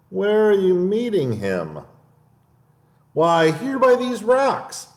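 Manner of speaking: high, polite mouse voice; the clever mouse turns down lunch; tiny prim mouse; little mouse. Acted. Mouse describes the Gruffalo.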